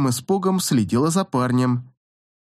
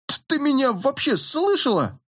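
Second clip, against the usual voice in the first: sharp, higher outburst; exploding in anger; 10 semitones higher than usual. A narrator's reading. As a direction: snap back, fed up. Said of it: "взорвался Фред". (взорвавшись от раздражения)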